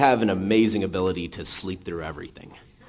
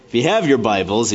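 ordinary talk, nothing marked

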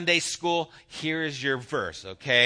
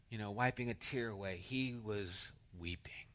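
whispered; 5 semitones lower, 14 dB quieter than usual. Real, not acted. Preacher hushes on weeping.

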